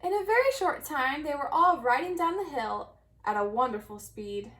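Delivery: ordinary delivery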